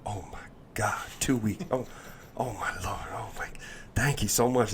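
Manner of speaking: breathless, hushed disbelief; stunned whisper over a first paycheck; awed whisper. Retold or imitated. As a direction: whisper it like you can't believe the number.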